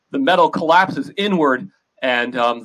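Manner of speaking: ordinary talk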